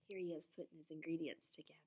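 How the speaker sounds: quick unvoiced whisper to the class. Real, not acted. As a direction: whisper it fast like a secret aside. Teacher aside while showing pictures.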